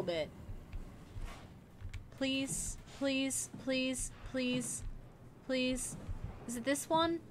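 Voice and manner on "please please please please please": much softer nervous begging; soft scared repeated please; hushed pleading. Real, not acted. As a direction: plead under your breath. Begging while sneaking past.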